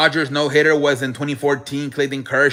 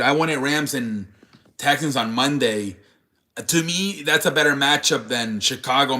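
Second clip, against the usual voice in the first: ordinary delivery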